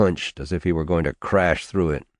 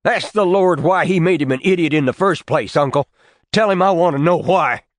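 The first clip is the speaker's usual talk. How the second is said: shouting higher and louder, voice breaking loose; losing control, shouting; 11 semitones higher, 5 dB louder than usual. Acted. Nephew shouting at his uncle about his idiot son, out of control. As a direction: let the anger get away from you. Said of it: "losing control of his voice".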